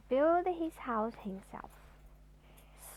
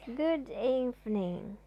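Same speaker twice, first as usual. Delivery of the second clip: very slow; slower than usual. Acted. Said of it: "talked in an extraordinarily slow way".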